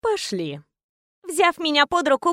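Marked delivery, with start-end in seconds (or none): 0.0-0.6 low, soft flat voice; low curt. Acted. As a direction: say it tiredly. Woman leads heroine away.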